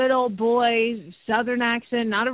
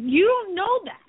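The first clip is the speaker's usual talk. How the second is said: higher-pitched mock protest; retold indignant objection; 9 semitones higher than usual. Horse owner challenging the vet's guess, retold indignant protest. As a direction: push back in a raised pitch, retelling it.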